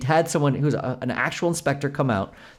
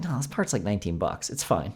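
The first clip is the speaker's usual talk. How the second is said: low, soft and offhand brush-off; mock dismissive dealer; 6 semitones lower, 8 dB quieter than usual. Dealer brushing off the customer, softly dismissive. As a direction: play down the price like a bored clerk. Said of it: "And that guy's like".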